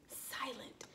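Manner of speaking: whisper. Shown as a demonstration. Teacher goes silent for pupils.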